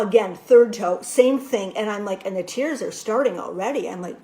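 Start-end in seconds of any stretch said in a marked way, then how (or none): none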